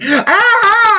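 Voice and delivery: high-pitched yelp imitating a hurt woman; retold cry of pain; mock pained scream. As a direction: squeal as if in pain, far above your own voice. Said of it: "She called me up. She's like".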